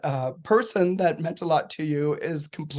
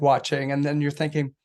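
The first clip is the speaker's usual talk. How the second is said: ordinary talk, nothing marked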